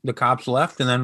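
normal talking voice

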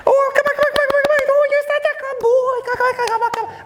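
put-on very high squeaky little-girl dog praise. Shown as a demonstration. Trainer showing how to call young and old dogs, little girl voice. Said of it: "I sound like a little girl".

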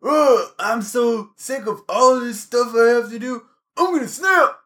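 high, hammed-up fed-up coworker; coworker's mock rant. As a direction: whine it, climbing up to snap. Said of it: "he said".

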